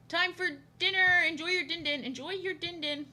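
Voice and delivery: high singsong call; playful dinner call. Pretend. Calling someone to enjoy their din din, playful high voice.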